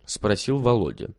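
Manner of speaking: plain reading voice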